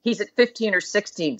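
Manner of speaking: ordinary delivery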